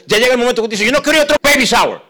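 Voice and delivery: high, loud fed-up cry; loud, pained refusal; exasperated outcry. Acted. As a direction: shout it, worn out by waiting. Frustrated couple voiced.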